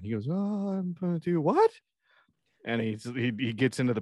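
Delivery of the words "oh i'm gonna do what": high-pitched dorky alarm; mock-stunned, voice shooting up; startled disbelief. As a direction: squeak it out as the hapless coworker. Scott startled by his assignment.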